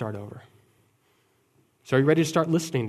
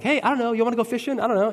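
casual shrug; 10 semitones higher, faster than usual. Retold or imitated.

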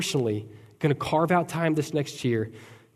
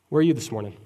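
normal talking voice

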